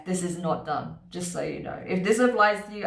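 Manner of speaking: ordinary talk, nothing marked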